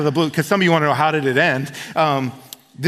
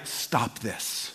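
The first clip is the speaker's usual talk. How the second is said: a soft, unvoiced whisper of God's voice; the Spirit whispering to an eighteen-year-old, hushed; 6 semitones lower, 12 dB quieter than usual. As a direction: breathe it like an inner voice. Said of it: "But he's like".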